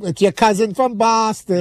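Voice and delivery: put-on Boston accent, slow and drawn out; imitating a Boston beer-ad cousin. Guy in a stall mocking Boston fans, slow Boston accent. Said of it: "You know that commercial about".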